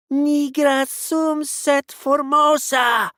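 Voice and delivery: high, loud, slow possessed yell; yelled Latin. Acted. Possessed woman yelling Latin at exorcist, high yell. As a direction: scream the Latin at the priest. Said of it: "Rosaria yelled in Latin".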